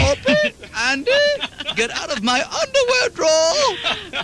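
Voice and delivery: squeaky mock plea; hammed-up high whine. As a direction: whine it in a high pitch like a cartoon. Comic Andy Griffith bit.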